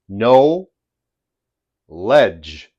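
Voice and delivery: "No ledge" as two separate words, long pause between; split into two words. Shown as a demonstration. Teacher shows students the word's two parts, "No ledge" split apart. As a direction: say "No", then "ledge", each stressed on its own. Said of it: "That's the correct pronunciation".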